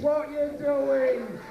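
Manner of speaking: exasperated. Acted. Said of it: "comic repeats the question".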